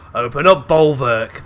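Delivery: loud yell to a comrade. A narrator's reading. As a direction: yell the order at full volume. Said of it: "he says before yelling".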